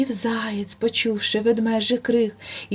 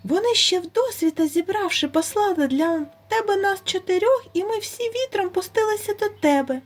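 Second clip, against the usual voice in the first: high, timid and very polite hare voice; frightened hare pleading with the bear; most politely; 7 semitones higher than usual. A narrator's reading. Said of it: "найчемніше".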